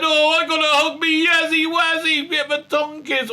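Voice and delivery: high, loud, cooing Tennant-style gush; mock fawning Doctor. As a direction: coo it at Yaz like a lovestruck fool. Doctor fawning over Yaz.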